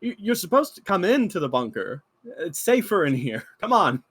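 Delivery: lightly voiced guard urging them into the bunker; reassuring. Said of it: "kind of like".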